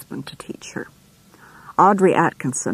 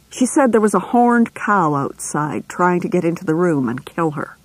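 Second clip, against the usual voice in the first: plain reading voice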